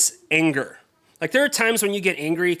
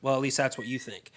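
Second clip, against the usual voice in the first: ordinary talk, nothing marked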